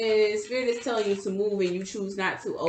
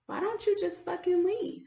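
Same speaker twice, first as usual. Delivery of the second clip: higher, softer exasperated question to herself; pleading with herself, pitched up; exasperated self-talk; 10 semitones higher, 6 dB quieter than usual. Retold or imitated. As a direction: ask yourself in high, hushed exasperation. Retold frustrated self-talk.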